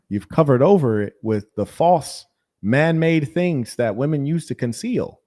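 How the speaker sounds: ordinary talk, nothing marked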